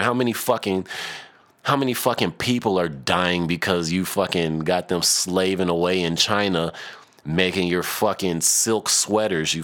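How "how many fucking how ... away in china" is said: usual speaking voice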